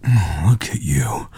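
slow, soft whisper; hushed and drawn out. Acted. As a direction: marvel under the breath. Softly marvelling at the partner.

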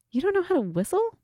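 high, fast disbelieving question; can't believe what you hear; incredulous. Real, not acted.

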